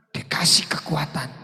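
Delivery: hushed whispered payoff; sudden whisper. Acted. Soft climax after loud preaching.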